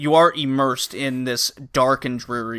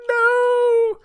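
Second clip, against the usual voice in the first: much higher, louder desperate cry; desperate plea; 5 dB louder, much slower than usual. Retold or imitated. Girl pleading with her father for the dog, high desperate cry. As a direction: beg them not to kill the dog. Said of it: "And she's like".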